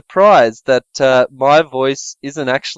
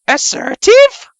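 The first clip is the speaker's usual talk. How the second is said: mock quote; 4 dB louder, slower than usual. Mocking a commenter's word.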